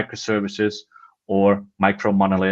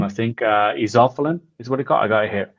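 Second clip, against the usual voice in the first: usual speaking voice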